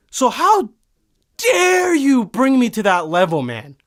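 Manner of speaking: high, drawn-out DARE, then falling; indignant outburst. Acted. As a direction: scold the driver with theatrical outrage. Indignantly addressing the absent driver.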